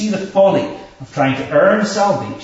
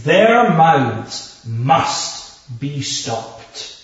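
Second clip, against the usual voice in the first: very slow, emphatic scripture quote; strong statement; much slower than usual. Retold or imitated. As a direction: weigh each word, pausing between them. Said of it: "says this very strong statement".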